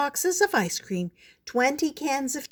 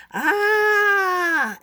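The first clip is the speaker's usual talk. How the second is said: long high scream, held out; startled yell; 12 semitones higher, 5 dB louder than usual. A narrator's reading. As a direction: yell out in fright, high and loud. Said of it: "The man yelled".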